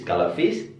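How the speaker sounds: ordinary delivery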